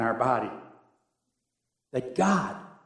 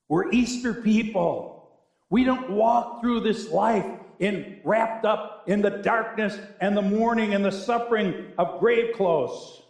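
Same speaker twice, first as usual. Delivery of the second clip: slow, raised proclamation; weighty, high-pitched pulpit conviction; emphatic preaching; 6 semitones higher than usual. Real, not acted. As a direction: declare it to the whole congregation. Preaches with force.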